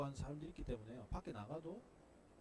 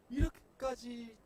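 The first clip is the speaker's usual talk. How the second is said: drawn-out complaint; 8 semitones higher, 7 dB louder, slower than usual. Pretend. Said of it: "라고 했을 때".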